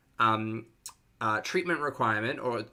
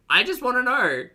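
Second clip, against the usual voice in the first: high, loud squeal of curiosity; insistent plea; 13 semitones higher, 7 dB louder than usual. Real, not acted. Host desperate to understand the video, high and insistent. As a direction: plead loudly in a shot-up pitch.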